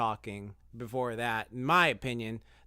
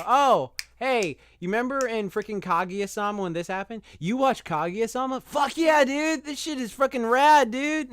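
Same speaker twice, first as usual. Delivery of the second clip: much higher and louder, gushing about Kaguya-sama; hammed-up fanboy; 9 semitones higher, 7 dB louder than usual. Guy messaging her about an anime, over-the-top fanboy. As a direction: bro out over a favorite show.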